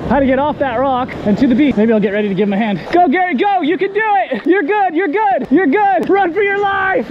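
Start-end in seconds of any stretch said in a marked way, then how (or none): 2.9-7.1 high, loud shouts of encouragement; urgent cheering yell. Real, not acted. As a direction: yell your buddy on as he makes a run for it. Cheering friend on across the rocks.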